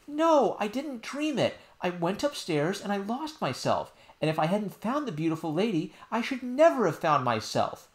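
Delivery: put-on high child voice, pleading; a little girl explaining earnestly; insistent princess. Princess telling nurse what happened, high and earnest.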